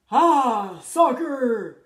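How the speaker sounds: loud gruff pirate shout; pirate captain shouting while kicking the ball. A narrator's reading. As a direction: kick and bellow it. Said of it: "en criant".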